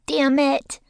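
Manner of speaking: put-on high, loud little kid curse; toddler cursing. A narrator's reading. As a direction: blurt it like a small child.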